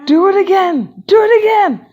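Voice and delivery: high, loud chant of demand; eager begging. Acted. Class begging teacher to repeat trick, loud and high.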